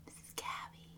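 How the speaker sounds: soft whispered take; whispered demo. Shown as a demonstration. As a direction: whisper it again for the camera. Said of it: "do it again".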